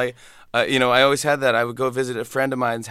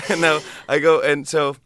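ordinary talk, nothing marked

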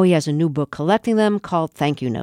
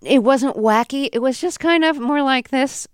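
nervous high radio voice; 7 semitones higher than usual. Shown as a demonstration. Host demonstrating her old radio voice, pitched up. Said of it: "What was your other voice".

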